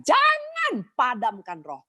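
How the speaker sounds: high, long-drawn "jangan"; divine command. Acted. Preacher quotes God's word to the congregation, stern divine command. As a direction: proclaim it slowly, pitched high. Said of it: "firman Tuhan jelas mengatakan".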